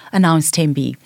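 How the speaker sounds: plain reading voice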